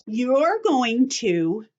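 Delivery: normal talking voice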